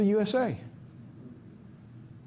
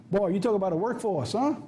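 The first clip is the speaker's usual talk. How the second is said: ordinary delivery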